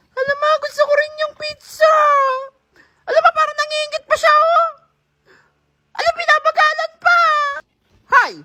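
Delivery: put-on whiny child voice. Hungry kid watching.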